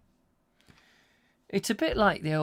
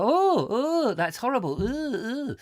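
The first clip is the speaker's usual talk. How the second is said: high squeal of disgust; disgusted girls; 8 semitones higher than usual. Retold or imitated. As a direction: squeal it like a shocked girl. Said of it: "like this".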